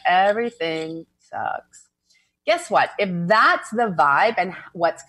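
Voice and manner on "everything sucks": very slow, drawn-out gloom; gloomy Eeyore. Acted. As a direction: drag it out like a mopey cartoon donkey. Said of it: "Eeyore's always gloomy".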